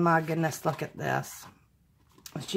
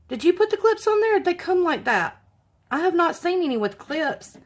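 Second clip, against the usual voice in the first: surprised question; 10 semitones higher, faster than usual. Real, not acted. Discovers cards have clips.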